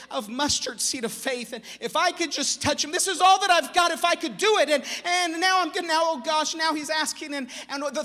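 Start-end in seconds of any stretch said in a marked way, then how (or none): 1.5-6.5 rising, much louder and higher, pleading; desperate plea. Acted. Sick woman to herself, a loud, high, desperate plea. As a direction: she gathers all her courage to reach him.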